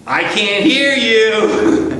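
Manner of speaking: high, loud, slow call to the chorus from the last seat; calling from the back. Retold or imitated. Choir leader in the back seat calls to singers, loud and slow. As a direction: stretch it out high and loud to reach the back row. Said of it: "you know what she would say?".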